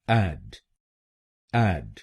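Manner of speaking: एड said with the "a" of "add"; American syllable demo. Shown as a demonstration. Teacher sounding out the American first syllable. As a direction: open the vowel in एड, like "add". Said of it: "एडी हम बोलेंगे".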